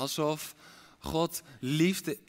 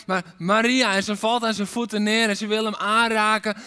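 louder, higher fervent preaching; forceful preaching; 5 semitones higher, 6 dB louder than usual. Acted. Preacher rousing the congregation, loud and fervent. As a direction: proclaim it with mounting fire.

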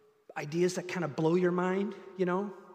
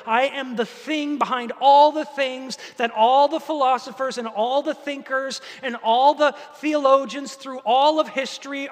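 higher and louder, building to a thunderous climax; preaching at full force; 5 semitones higher, 7 dB louder than usual. Acted. Pastor voices God declaring himself, building to a roar. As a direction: proclaim it bigger with each line.

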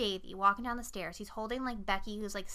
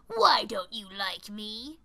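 slow rasping smoker's growl; gravelly smoker's voice; slower than usual. Retold or imitated. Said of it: "weird, like, smoker's, like, gravelly voice".

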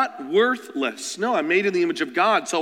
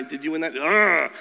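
usual speaking voice

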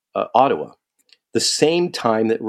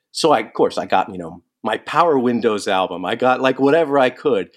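ordinary delivery

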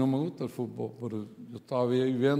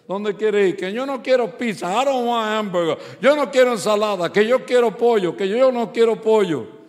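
higher, louder, bickering voices; mock family squabble, raised voices; squabbling family; 7 semitones higher, 6 dB louder than usual. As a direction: act out the parking-lot quarrel. Family fighting over where to eat.

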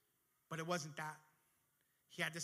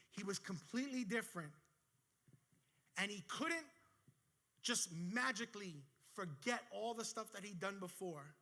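preaching; 3 semitones higher than usual. Acted. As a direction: drive the point home to the congregation. Sermon climax, preaching.

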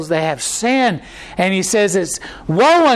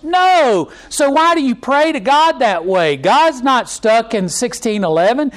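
ordinary talk

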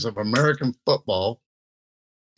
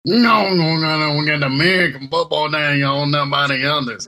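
Louisiana accent. Shown as a demonstration. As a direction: lay on a Cajun Louisiana accent. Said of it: "Can you say that with a Louisiana accent".

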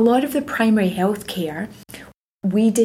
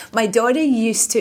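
usual speaking voice

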